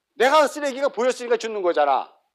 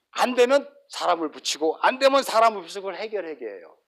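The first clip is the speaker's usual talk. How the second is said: ordinary delivery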